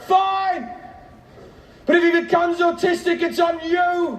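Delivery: imitated wife's high, strained scream; shrieked ultimatum, pitched far up. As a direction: scream a furious concession across the street. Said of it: "she screams out".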